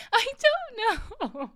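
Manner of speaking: high, slow, squeezed out through laughter; can barely get the words out for laughing; laughing helplessly. Real, not acted. Laughing at coworker's absurd story.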